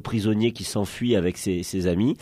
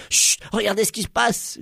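chut alarmé; 8 semitones higher than usual. Acted. Suzette fait taire les autres.

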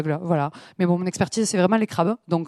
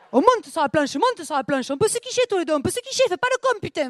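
put-on southern French accent, pleading higher and higher; southern Rose; 9 semitones higher than usual. Rose pleading with Jacques to climb on, southern accent. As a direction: beg him onto the plank in a sing-song southern accent. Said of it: "à la place de Rose dans Titanic".

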